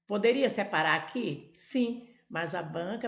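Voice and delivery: normal talking voice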